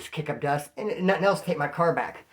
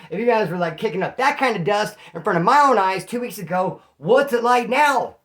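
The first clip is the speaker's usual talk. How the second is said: building accusation; 4 semitones higher, 6 dB louder than usual. Retold or imitated. Accusing girlfriend in the car.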